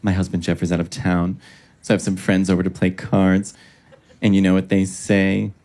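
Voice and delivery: low, measured hostess voice, pleased with herself; Barefoot Contessa. Retold or imitated. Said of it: "she was giving the intro and she goes".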